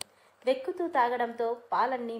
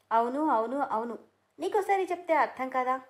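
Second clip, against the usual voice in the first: loud, higher repeated yes; angry insistence; 3 semitones higher, 4 dB louder than usual. A narrator's reading. Siri shouts yes at Surya in their quarrel, loud. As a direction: hammer the yes at him.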